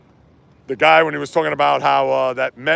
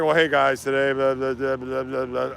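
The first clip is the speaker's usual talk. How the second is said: imitating a dull host, trailing off softer into blah blah; mock boring host; 3 semitones lower, 6 dB quieter than usual. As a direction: mumble it like a boring intro, sinking away. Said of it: "this guy is putting me to sleep".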